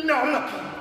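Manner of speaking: high, sharp squawk; squawking creature. Acted. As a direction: squawk it like a startled old bird-creature. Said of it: "he squawked".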